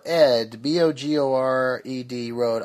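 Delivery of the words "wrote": ordinary talk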